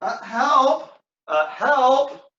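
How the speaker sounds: high, loud wounded cry; groan, then yell for rescue; calling for help. Acted. Wounded Cassio on the ground calls to passers-by, high pained yell.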